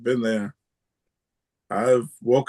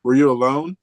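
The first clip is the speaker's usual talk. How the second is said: repeated louder so it's heard; 3 semitones higher, 6 dB louder than usual. Real, not acted. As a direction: say it again, louder and clearer, rising on "you". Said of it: "I'm sorry?".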